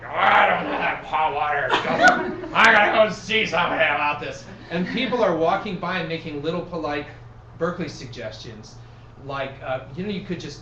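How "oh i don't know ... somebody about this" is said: a hint of a grumbling old man; mutter-grumble like a cranky old man. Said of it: "he's still grumbling".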